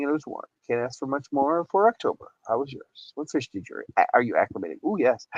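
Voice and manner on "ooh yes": ordinary talk, nothing marked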